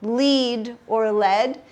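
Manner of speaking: slow contrast of long-e lead and short-e led; two pronunciations. Shown as a demonstration. Teacher shows students heteronyms, two pronunciations of lead, led. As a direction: LEAD said as leed, then LED, slowly. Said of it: "just pronounced differently".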